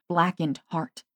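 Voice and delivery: ordinary delivery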